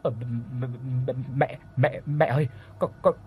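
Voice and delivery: begging a ghost, building from whisper to frantic; stuttering fear swelling into a frantic plea; terrified pleading. Acted.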